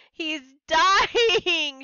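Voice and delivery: high, loud panicked shout. Acted. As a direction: shout it in alarm. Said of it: "she shouted".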